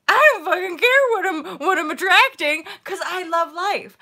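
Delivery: high, loud burst of elation; exuberant outburst. Acted. Vlogger declaring she loves life, exuberant. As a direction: belt it out, thrilled. Acting out her own exuberant attitude.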